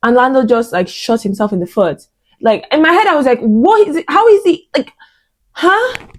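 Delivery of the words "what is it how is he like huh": much higher, incredulous inner voice; higher-pitched baffled inner voice; baffled shock. Retold or imitated. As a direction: squeak out confused disbelief. Inner reaction to crash.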